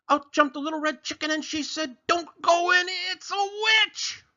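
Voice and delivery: very high, loud chicken warning; chicken bursting in. Acted. Chicken barges into the story, shrieking high.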